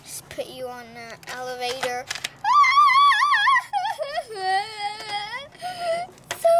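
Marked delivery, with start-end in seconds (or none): 2.1-6.4 high loud haunted-house cackle; spooky laugh. Pretend. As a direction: cackle like a ghost. Spooky ride, "So scary.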